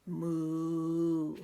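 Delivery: long, loud, low moo; pretend cow moo. Characters pretend together at the end, long low moo. Cow noise ending the story.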